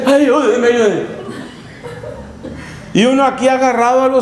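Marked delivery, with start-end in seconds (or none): 0.1-1.0 put-on old woman crying for help. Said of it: "vuelve y sale".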